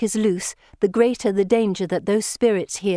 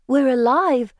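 higher, louder relieved gasp; surprised and relieved; 6 semitones higher, 5 dB louder than usual. A narrator's reading. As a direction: breathe out, amazed to be alive. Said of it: "both surprised and relieved".

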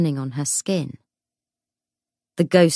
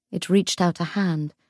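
ordinary delivery, nothing marked

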